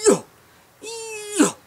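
quick snapped "Yo" off the tongue; clipped grunt "yo, yo". Shown as a demonstration. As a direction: snap each syllable out fast. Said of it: "my tongue is going".

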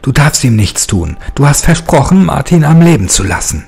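high, loud cry of a name; desperate shout. Acted. Devox shouts at his master to spare Martin. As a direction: yell to stop him at once. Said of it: "schrie Devox".

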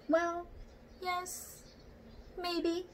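high, timid orangutan conceding; shy orangutan. A narrator's reading. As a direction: answer shyly, unsure.

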